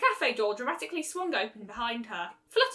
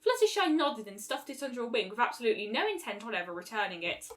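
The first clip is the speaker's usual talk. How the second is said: ordinary delivery, nothing marked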